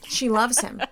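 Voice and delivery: usual speaking voice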